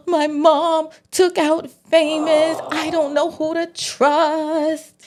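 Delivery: high, slow imitation of tearful wailing; gasp, then act out a drawn-out weepy complaint; hammed-up crying, pitched high; mock crying. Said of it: "Kanan crying in Crystal's arms".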